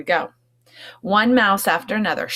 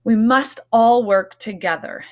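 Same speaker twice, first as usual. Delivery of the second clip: firm appeal; 5 semitones higher, 6 dB louder than usual. Acted. Mouse urging unity.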